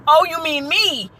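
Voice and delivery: high, slow, disbelieving question; surprised realization. Retold or imitated. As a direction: slowly realize they mean you. Realizing she means her.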